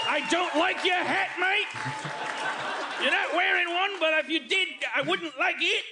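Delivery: high-pitched, yelling mock Aussie; Australian accent. Homeowner chasing off Jeff the kangaroo, shouted mock Australian accent. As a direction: bellow in a hammed-up Australian accent. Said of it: "I can't do an Australian accent".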